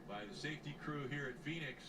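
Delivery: usual speaking voice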